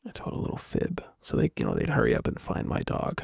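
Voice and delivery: low hushed aside; whispered aside. Real, not acted. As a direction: drop to a secret whisper. Confessing fib, voice drops unvoiced.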